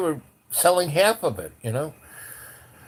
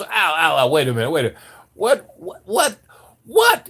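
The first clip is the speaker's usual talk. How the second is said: loud stammer, climbing ever higher on each word; flustered sputtering; 4 semitones higher, 5 dB louder than usual. Retold or imitated. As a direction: imitate a cop too dumbfounded to speak. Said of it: "He can't even get the words out".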